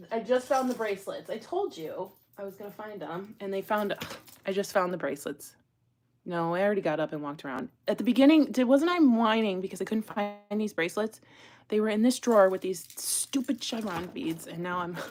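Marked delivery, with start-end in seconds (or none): none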